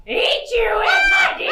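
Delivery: loud rising snarl; big hammed-up pounce; pouncing roar. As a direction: lunge with the line. Wolf pounces.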